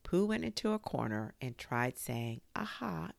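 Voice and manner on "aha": knowing aha. A narrator's reading. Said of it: "in that sort of voice".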